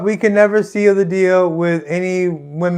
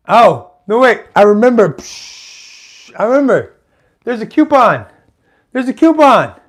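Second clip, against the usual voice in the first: sudden eureka, higher pitched and punchy; excited realization; 5 semitones higher, 4 dB louder than usual. Retold or imitated. Telling homie he remembers a coupon, excited. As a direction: blurt it out as it dawns on you.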